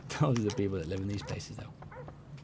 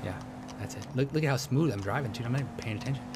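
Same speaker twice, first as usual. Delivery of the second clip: ordinary talk, nothing marked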